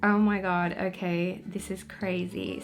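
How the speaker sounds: ordinary delivery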